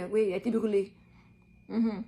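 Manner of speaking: ordinary delivery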